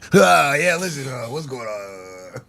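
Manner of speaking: low, slurred put-on anchor voice; mock drunk anchor. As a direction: play a tipsy newsreader mumbling.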